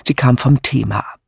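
ordinary delivery

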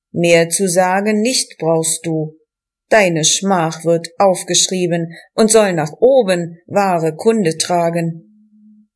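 plain reading voice

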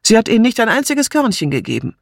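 quick, high-pitched complaint; annoyed exclamation. A narrator's reading. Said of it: "rief Martha". (verärgert und schnell geschimpft)

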